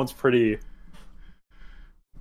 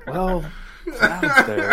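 laughing; 9 semitones higher, much slower than usual. Retold or imitated. Mock inner voice, laughing.

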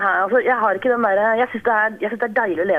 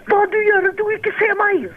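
angry Polish man yelling after a parking bump; retold angry yell; 11 semitones higher than usual. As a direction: bark it at her like a furious driver. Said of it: "Skrek han til meg".

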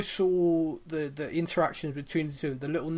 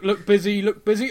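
louder laughing mock panic; 3 semitones higher, 7 dB louder than usual. Man pretending to be busy, laughing mock panic. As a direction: hiss it urgently, half laughing.

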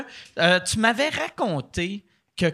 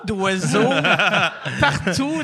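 giggling through the words; laughing while quoting; 2 semitones higher, 6 dB louder than usual. Retold or imitated. Retold quote while laughing.

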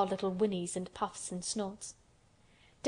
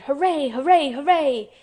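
loud, high, swooping cheer; shouted hooray; 5 semitones higher, 9 dB louder than usual. A narrator's reading. A strong boy's imagined triumph, shouted. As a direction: cheer it out at full voice. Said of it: "would probably have shouted".

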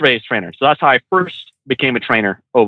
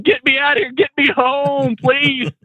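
high, drawn-out comic begging; hammed-up pleading, stretching the words; mock desperate plea; 11 semitones higher, slower than usual. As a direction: plead to be rescued, pitched way up. Acted plea to escape the scene.

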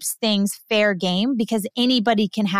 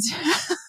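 small high giggle; short light laugh; 7 semitones higher, 5 dB quieter than usual. Real, not acted. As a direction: giggle softly to yourself. Amused at her story.

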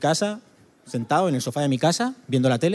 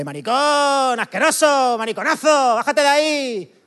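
much higher, louder heckling yell; jeering crowd; 11 semitones higher, 3 dB louder, slower than usual. Retold or imitated. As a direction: scream abuse at a boxer in the ring. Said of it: "me ponía ahí la gente".

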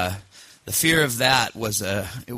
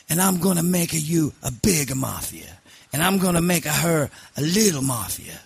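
raised-pitch mock Sicilian accent; Sicilian godfather; 5 semitones higher than usual. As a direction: bestow an honour like a hammed-up Sicilian don. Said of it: "Sicilian mob boss once".

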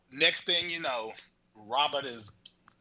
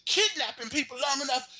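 mock exasperated proposer; 12 semitones higher than usual. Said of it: "And he's like".

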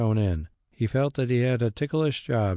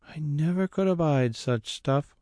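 muttered; 6 semitones higher than usual. A narrator's reading. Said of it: "muttered Mary".

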